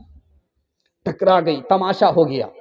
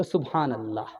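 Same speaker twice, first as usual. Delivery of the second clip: very soft, low daughter's answer; hushed, dropped-voice reply; demure reply; 9 semitones lower, 12 dB quieter than usual. Acted. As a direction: answer shyly under your breath. Daughter's demure answer about husband.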